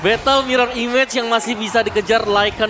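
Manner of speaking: loud, high excited play-by-play; esports caster. Real, not acted. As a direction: keep the energy up, calling it. Third caster rides the hype.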